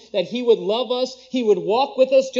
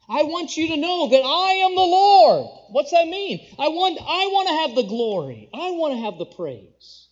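fervent preaching; 7 semitones higher, 5 dB louder than usual. Acted. Preacher voicing God, rising force.

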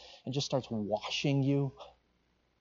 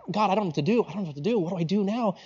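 mildly helpless, higher-pitched plea; bewildered pleading; 6 semitones higher, faster than usual. Retold or imitated. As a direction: act out asking God for direction, at a loss. Acted bewildered plea to God.

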